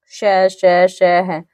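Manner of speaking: श drawn out as a long breathy hiss; hissing श demo. Shown as a demonstration. Teacher showing warm air on fricatives, hissed श. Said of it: "गर्म हवा होती है वो बाहर निकलती है".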